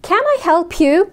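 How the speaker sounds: "Can I help you?" slow and high, voice rising at the end on you; rising question tune. Shown as a demonstration. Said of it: "ناخد بالنا من الصوت". (نغمة صاعدة في آخر السؤال)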